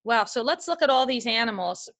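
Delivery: ordinary talk, nothing marked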